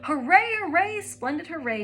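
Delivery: high, loud gleeful cries; shrill cheer. Acted. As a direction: whoop while dancing a jig. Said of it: "cried in her shrill little voice".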